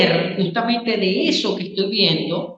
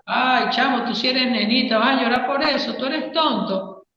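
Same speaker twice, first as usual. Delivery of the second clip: higher, taunting put-down of a crying friend; sneering macho jeer, raised pitch; mocking taunt; 5 semitones higher than usual. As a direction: tease him like a macho buddy, pitched up.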